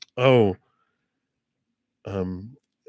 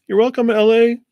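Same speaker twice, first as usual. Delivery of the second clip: bright greeting; 9 dB louder than usual. Real, not acted. Greeting a viewer.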